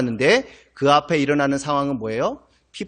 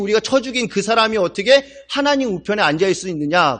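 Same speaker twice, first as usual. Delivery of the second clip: normal talking voice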